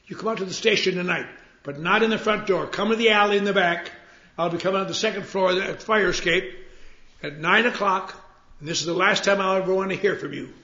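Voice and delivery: laying down the law one last time; fed-up order. Retold or imitated. Ted telling the bum to come to the back alley, loud and fed up. Ted angrily setting final terms.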